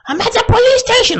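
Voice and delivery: hammed-up high girl voice; mock sister. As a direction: squeal it like the tipsy sister. Sister answering on phone.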